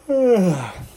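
loud, high falling yawn. A narrator's reading. Rat just woken up, yawning. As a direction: yawn it out on a long sigh. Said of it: "The rat yawned".

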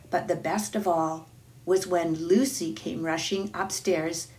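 ordinary delivery, nothing marked

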